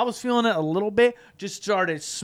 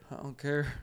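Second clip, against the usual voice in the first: mock stoned murmur; imitating stoned helplessness; 6 semitones lower, 11 dB quieter than usual. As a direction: mumble it softly, as if barely able to talk. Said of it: "My mouth won't open".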